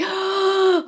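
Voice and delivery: sharp high gasp on the way up; mock gasp on the roller coaster. To viewers, acting out a roller coaster climb, high gasp. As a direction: suck in air as the ride climbs. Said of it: "holding your breath".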